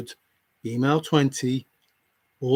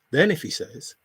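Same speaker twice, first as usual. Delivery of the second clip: usual speaking voice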